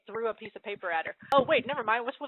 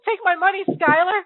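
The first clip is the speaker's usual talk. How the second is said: put-on high, loud swooning girl's offer; hammed-up lovestruck anime girl; mock sweet waifu; 8 semitones higher, 10 dB louder than usual. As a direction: throw herself at him, swooning. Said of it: "sweet waifu's like".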